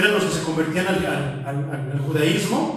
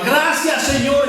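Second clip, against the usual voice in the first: fervent prayer; 6 semitones higher than usual. Retold or imitated. A believer speaking in tongues, fervently thanking God. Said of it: "Imagínate en otro idioma".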